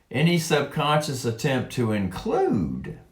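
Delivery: reading aloud, hitting "include"; emphasis on include. Shown as a demonstration. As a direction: give "include" a clear push. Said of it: "let me put more emphasis on the word include".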